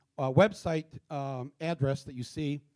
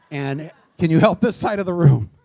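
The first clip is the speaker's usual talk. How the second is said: loud, projected request with a laugh; calling across room; 11 dB louder than usual. Real, not acted. Speaker asks audience helper to assist others, loud amused call. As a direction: project it loudly to the far side.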